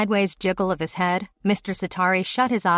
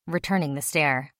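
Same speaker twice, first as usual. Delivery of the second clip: usual speaking voice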